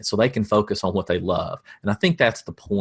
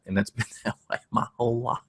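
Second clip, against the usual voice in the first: laughing through it; 6 dB quieter, faster than usual. Real, not acted. Laughing at his own dry humor.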